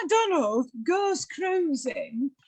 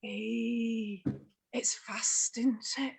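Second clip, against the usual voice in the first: softer, breathy-hushed Yorkshire gush; 8 semitones lower, 9 dB quieter, much slower than usual. Retold or imitated. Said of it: "she goes".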